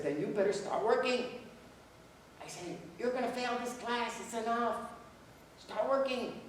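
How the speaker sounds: high-pitched, pushed scolding; stern warning. Retold or imitated. Professor warning a failing student, high and stern. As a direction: raise the voice to jolt him into working.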